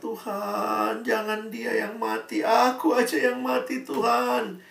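high, pleading mock cry to God; imitating a mother's cry. Grieving mother crying to God beside her child, high plea. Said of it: "Mamanya teriak-teriak".

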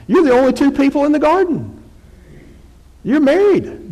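very high-pitched playful objection; mock protest. Preacher argues the couple's case, high mock protest. Mock argument about being naked.

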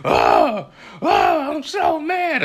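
put-on high, wailing kid whine; imitating a pouting daughter; mock whiny pout. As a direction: whine like a sulking little girl. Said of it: "she like".

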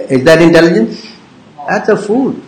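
normal talking voice

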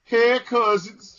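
put-on New Orleans accent. Host tries a New Orleans accent for the listener. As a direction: stretch out hey cousins in a New Orleans accent. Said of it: "she said in my New Orleans accent".

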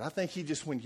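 usual speaking voice

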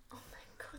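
voiceless whispered aside. Real, not acted. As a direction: whisper it under the music. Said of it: "du musst flüstern".

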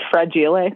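fragile as fra-JEE-lay; playful mispronunciation. Shown as a demonstration. Sharing the family's own word, fra-JEE-lay. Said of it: "what we do say is fragile".

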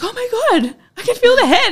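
high, loud excited exclamation; shocked, thrilled cry; excited squeal. Retold or imitated. Retelling feeling baby's head.

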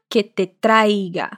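que te traiga drawn out slowly; slow model phrase. Shown as a demonstration. Teacher models the Spanish form slowly for learners.

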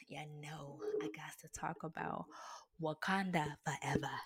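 hushed, secretive whisper. Real, not acted. Vlogger whispering the next topic to viewers.

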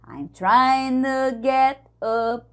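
"I'm trying to get up" with trying and to run together, slow; slow demo of the linking; linked words. Shown as a demonstration. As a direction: let trying flow into to. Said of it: "a gente também pode falar".

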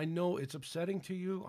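ordinary talk

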